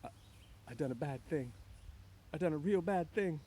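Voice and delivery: scared confession in a character voice, not truly whispered; frightened. A narrator's reading. Lenny realising he has killed her, frightened. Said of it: "he whispered in fright".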